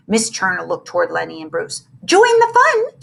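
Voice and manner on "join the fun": high, loud cheerful urging. A narrator's reading. Librarian urging two bored boys to join the song, high and loud. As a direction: coax the boys in brightly. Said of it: "she urged them".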